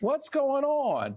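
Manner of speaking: high, slow, puzzled mock voice; mock FBI agent. Host voices the FBI wondering, in a high, slow puzzled voice. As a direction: play the curious agent, lightly hammed.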